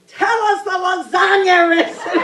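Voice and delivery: high-pitched comic shriek; imitating a desperate yell for the recipe; retold scream. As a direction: scream the demand, on the edge of laughter. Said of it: "I was screaming".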